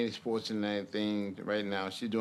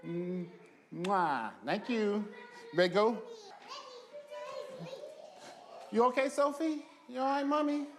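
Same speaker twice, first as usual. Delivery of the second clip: high, sing-song voice for toddlers; 12 semitones higher than usual. Real, not acted. Father talks to toddler daughters.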